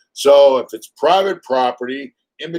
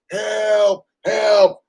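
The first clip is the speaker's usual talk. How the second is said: higher mock cries of help; mock yell for help; 8 semitones higher than usual. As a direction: imitate his yelling, half-hearted. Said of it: "he's like".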